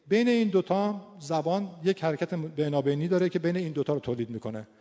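usual speaking voice

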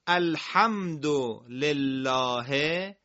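very slow, stretched-out recitation; Quran recitation. Shown as a demonstration. Teacher reciting the verse for pupils, slow measured recitation. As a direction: draw out each word for learners.

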